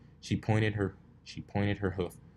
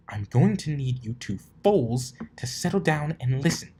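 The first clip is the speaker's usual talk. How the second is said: higher, louder scolding command; stern; 6 semitones higher, 6 dB louder than usual. Acted. Rarity scolding the two fools, stern command. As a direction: lay down the law.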